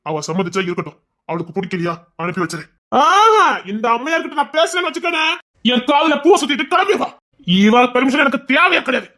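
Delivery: ordinary talk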